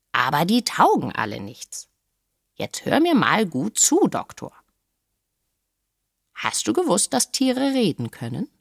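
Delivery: knowing parrot, swooping up in pitch; lightly voiced parrot confiding a secret; Polynesia the parrot. A narrator's reading. Parrot tells the doctor to listen closely, knowing.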